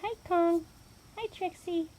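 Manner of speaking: high sing-song talk to dogs; pet voice. Real, not acted. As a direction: coo at the dogs. Greeting her dogs.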